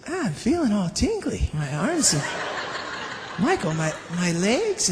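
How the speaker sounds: lightly voiced dazed, giggly Jason; tipsy friend. Jason after the meeting, mildly dazed and giggly. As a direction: sound pleasantly woozy and tingly. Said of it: "he says".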